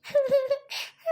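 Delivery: high taunting cackle; mocking laugh. Mother-in-law laughing at the crying daughter-in-law. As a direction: laugh at her scornfully.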